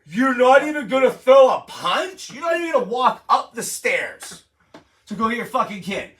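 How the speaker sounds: high, loud comic outrage; indignant, laughing outburst; incredulous rant. Real, not acted. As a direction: demand it in exasperated disbelief. Outraged at the hero giving up.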